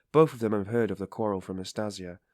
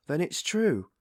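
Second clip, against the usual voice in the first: dismayed cry; 9 semitones higher, 5 dB louder, slower than usual. A narrator's reading. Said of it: "cried Pulcheria Alexandrovna".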